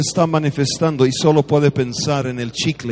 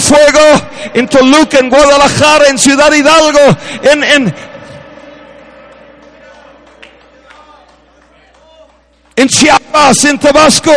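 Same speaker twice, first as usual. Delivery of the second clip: shouted roll call of cities, high and loud; preaching at full force; 11 semitones higher, 8 dB louder than usual. Acted. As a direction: call each city out to the crowd. Preacher calling out city names. (predicando a todo pulmón)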